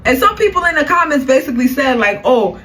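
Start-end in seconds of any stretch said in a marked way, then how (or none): none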